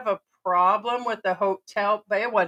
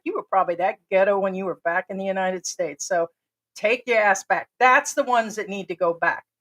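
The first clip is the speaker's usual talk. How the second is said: ordinary talk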